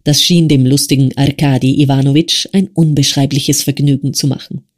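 ordinary delivery

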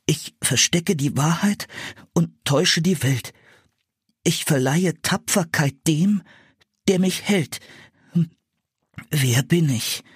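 put-on boy's voice, shivering with cold; trembling from the freezing air. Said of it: "sagte Philipp zitternd".

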